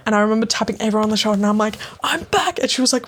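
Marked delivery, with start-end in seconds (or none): 2.0-2.6 high, bright triumphant "I'm back"; triumphant announcement. Retold or imitated. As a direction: proudly announce your return. Triumphant return to friend.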